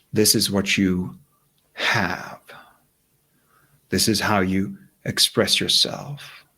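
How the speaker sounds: calm meditation voice with long pauses; guided meditation. Real, not acted. Guide asking listeners to picture their wish, calm meditation voice. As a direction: lead them gently inward, leaving silence between phrases.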